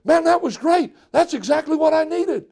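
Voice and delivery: woman leaving a friend, lightly voiced and thrilled; delighted relief. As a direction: gush about how good that felt. Woman leaves happy after talk.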